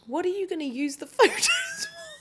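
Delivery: high, half-laughed quote of a nosy colleague; laughing mock question. Retelling a colleague's question at the photo shoot, pitched high through laughter. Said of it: "someone was like".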